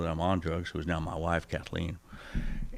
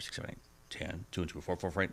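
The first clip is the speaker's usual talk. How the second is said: hushed; 10 dB quieter, faster than usual. Retold or imitated. Confused count fading.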